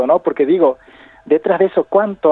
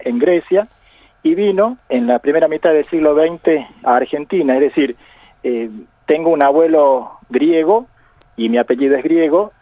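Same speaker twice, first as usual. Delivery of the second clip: normal talking voice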